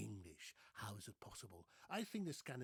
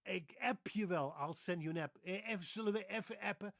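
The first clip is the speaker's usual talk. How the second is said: put-on high, loud Dutch and Dutch-accented English; exaggerated Dutch accent; Dutch speech; 6 semitones higher, 5 dB louder than usual. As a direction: act out a Dutchman talking about texting. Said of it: "They say".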